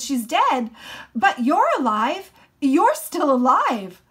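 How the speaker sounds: loud, high, giddy insistence; excited shouting. A narrator's reading. Son overjoyed his father still lives, shouting. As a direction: shout it as if overwhelmed with relief.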